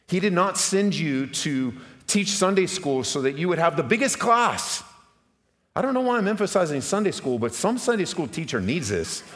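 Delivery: high, loud impassioned preaching; preaching at full force. Acted. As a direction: build it up and shout it to the congregation.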